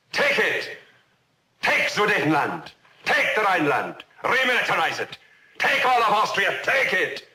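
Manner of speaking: loud, high exhortations building in force; urging shout. Acted. Witness voicing the world urging Hitler on, urging shout.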